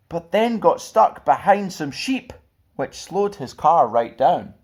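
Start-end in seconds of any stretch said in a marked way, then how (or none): none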